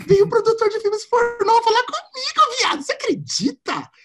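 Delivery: high, giddy squeal of news; excited gossip. Retold or imitated. Telling a friend the juicy news, high and giddy. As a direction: gush the gossip, voice shooting up.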